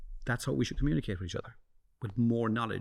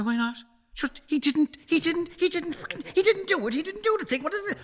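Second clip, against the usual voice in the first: very high, loud squeaky self-mockery; whiny mock retort; 5 dB louder than usual. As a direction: squeal it like a put-upon kid defending. Retorting to wife, mimicking himself.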